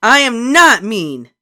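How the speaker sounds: slow, loud shout; shouting back. A narrator's reading. Beezus shouting back at Ramona, slow and loud. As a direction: shout every word back. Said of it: "Beezus shouted back".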